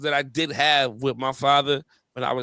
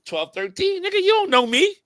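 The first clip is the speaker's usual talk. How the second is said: put-on defiant teenager; 7 semitones higher, 4 dB louder than usual. Retelling his teenage self's defiance.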